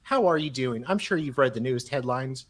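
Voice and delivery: normal talking voice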